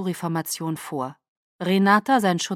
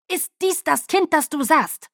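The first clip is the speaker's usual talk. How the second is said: higher, louder angry demand; snapping at her; 8 semitones higher, 5 dB louder than usual. A narrator's reading. Caius snapping at Irina after striking her, loud and high. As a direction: bark the question at her. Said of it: "fuhr Caius sie an".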